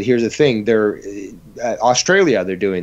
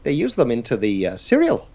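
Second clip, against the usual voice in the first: put-on Irish accent; 3 semitones higher than usual. Leprechaun joking about cereal cartel.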